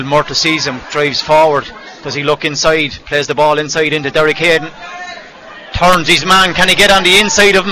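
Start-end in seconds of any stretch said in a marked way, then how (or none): 5.7-7.7 excited. Real, not acted. Foul called live in commentary.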